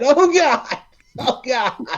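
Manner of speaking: high excited outburst; real thrilled exclamation; excited cry. Real, not acted. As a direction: yelp it out in delight. Excited reaction.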